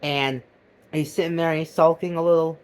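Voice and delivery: ordinary talk, nothing marked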